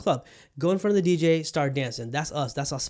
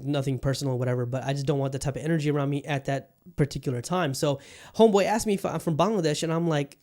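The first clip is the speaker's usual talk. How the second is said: ordinary talk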